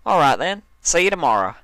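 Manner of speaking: bright friendly country goodbye; country mare. Acted. Applejack saying goodbye to Dash, high and cheery.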